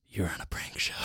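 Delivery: whispered reveal, as if sharing a secret; hushed, secretive whisper; confidential whisper. Retold or imitated. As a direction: whisper it like a retold confession. Prankster quietly reveals the secret.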